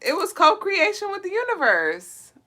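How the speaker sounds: high amused explanation; incredulous. Real, not acted. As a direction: explain it like it's obvious.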